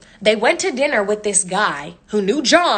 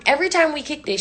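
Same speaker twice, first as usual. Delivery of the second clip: ordinary talk, nothing marked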